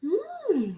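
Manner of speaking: high, soft questioning hum; mimicked wondering hmm; imitating a puzzled hum. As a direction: hum up high like a curious girl. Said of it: "And she's like ... like this".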